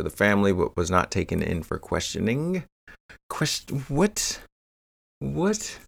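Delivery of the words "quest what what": high, soft disbelieving question; stunned what, pitched up and rising; incredulous. Real, not acted. Host reacting in disbelief to no questioning, incredulous.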